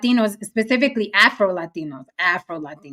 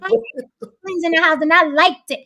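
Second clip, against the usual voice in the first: higher, put-on kid voice defending friends; mock younger self; 7 semitones higher than usual. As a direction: pipe up like a kid protecting your friends. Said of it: "we'd be like".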